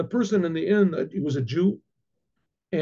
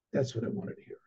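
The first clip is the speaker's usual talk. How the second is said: very soft, low reply; hushed realization; 4 semitones lower, 10 dB quieter, faster than usual. Retold or imitated. Rabbi answering the innkeeper's confession, hushed realization. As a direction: drop to a near-whisper.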